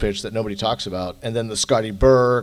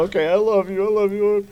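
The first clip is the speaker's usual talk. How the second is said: higher, choked-up retold goodbye; teary goodbye; 9 semitones higher than usual. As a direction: say it like you're still fighting tears. Tearful goodbye to daughter retold.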